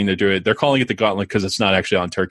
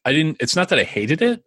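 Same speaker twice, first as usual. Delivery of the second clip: usual speaking voice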